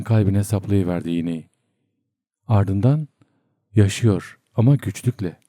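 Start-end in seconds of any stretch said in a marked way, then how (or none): none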